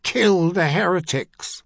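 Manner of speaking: slow, loud battle command; commanding shout. Acted. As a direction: bellow the order to attack.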